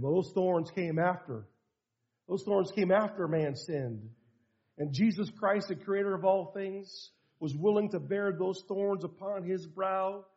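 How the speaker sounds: low, soft retold dad voice; gentle fatherly explanation. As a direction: explain it tenderly to a small child. Father gently explains to tearful son.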